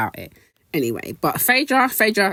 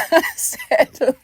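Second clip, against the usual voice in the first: laughing; 13 semitones higher than usual. Real, not acted. Laughing at Heavenly paying for ring.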